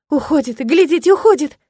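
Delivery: high, loud joyful cry; joyful shout. A narrator's reading. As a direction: shout it out in delight. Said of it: "раздался радостный крик". (радостный крик)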